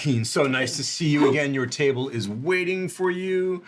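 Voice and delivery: high, loud, gushing welcome; effusive restaurant host. Acted. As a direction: fawn over a favourite regular guest. Game master greets as restaurant host.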